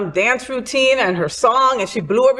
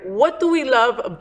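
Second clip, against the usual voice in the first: normal talking voice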